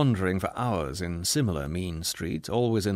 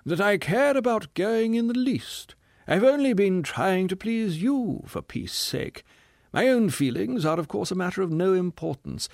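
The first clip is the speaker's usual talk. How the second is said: higher, aloof and affronted; a higher, injured gentleman's voice; dignified voice; 9 semitones higher than usual. Acted. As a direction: play the martyr with lofty dignity. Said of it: "said the man in a very dignified voice".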